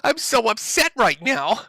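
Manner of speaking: put-on high, whining man-child begging his daddy; whiny Ethan impression. Streamer mocking Ethan begging daddy for advice, high whiny voice. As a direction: whimper it up high like a pouting grown son. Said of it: "But he decided".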